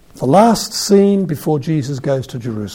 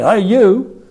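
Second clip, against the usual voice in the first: raised, louder casual call; 7 semitones higher, 6 dB louder than usual. Acted. Blind beggar calls to Jesus from the roadside, calling out. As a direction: hail a passer-by roughly. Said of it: "He probably said".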